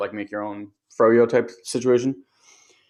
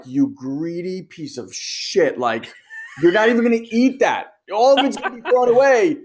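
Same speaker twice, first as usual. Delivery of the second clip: angry inner rant; 7 semitones higher, 4 dB louder than usual. Retold or imitated. Mom silently raging at her son over wasted ice cream, building to a shout. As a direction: rise from a seething mutter into a raging outburst. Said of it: "I'm so angry".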